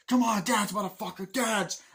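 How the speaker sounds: high, loud mock bullying yell; re-enacted jeering command; acted menacing taunt. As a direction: taunt him like a crazed soldier. Acts out soldier taunting villager.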